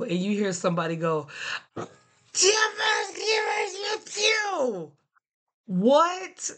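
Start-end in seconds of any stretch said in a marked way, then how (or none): 2.3-4.9 very high squeaky sneeze sounds in three beats; sneeze in syllables. Retold or imitated. Teacher mimicking a parent's gym sneeze, squeaky and high. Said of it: "So it went a little something like this". 5.7-6.5 surprised what. Retold or imitated. Baffled reaction to the sneeze.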